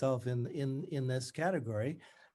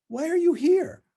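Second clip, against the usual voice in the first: much higher, louder, strained bright Scottish schoolmistress; strangulated faux brightness; 10 dB louder than usual. A narrator's reading. Tutor greets her old student, strangulated and falsely bright. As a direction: push it through gritted teeth with forced cheer. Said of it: "In her strangulated voice".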